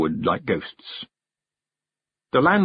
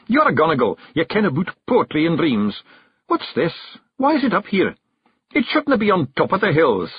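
higher Scots brogue, pressing questions; Rob, puzzled Scots chief; 7 semitones higher than usual. A narrator's reading. Rob presses young Billy about the strange horse, urgent Scots voice. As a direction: demand an answer from the lad.

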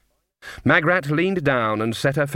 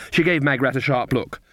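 ordinary delivery

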